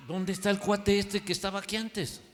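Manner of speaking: asombro recreado, en tono agudo; sorpresa imitada. Retold or imitated. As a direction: pregunta con incredulidad, subiendo la voz. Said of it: "Yo me quedé sorprendido". (pregunta de asombro, aguda)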